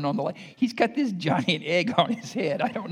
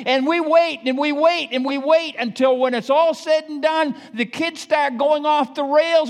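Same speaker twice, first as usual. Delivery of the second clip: preaching at full force; 10 semitones higher, 6 dB louder than usual. Real, not acted.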